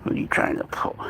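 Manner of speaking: soft, whispered mock suspicion; playful whisper. Real, not acted. To a mischievous baby animal, playful whisper. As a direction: whisper teasingly to a pet.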